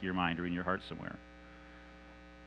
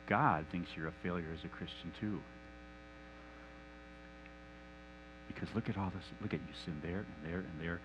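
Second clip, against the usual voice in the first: sly lying whisper; 6 semitones lower, 12 dB quieter than usual. Acted. Satan the liar softly telling Mike God is disappointed. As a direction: pass the lie off gently as God's word.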